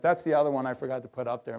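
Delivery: ordinary delivery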